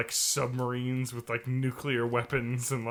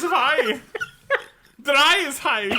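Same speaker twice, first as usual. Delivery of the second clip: much higher and louder, hammed up; mock German; 11 semitones higher, 6 dB louder, slower than usual. Friend topping the other's joke, high mock German. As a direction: climb up high in a fake German voice.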